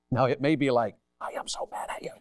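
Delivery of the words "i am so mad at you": mock-furious whisper; angry whisper. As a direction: fight entirely in a whisper, played for laughs. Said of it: "Always whisper when you fight".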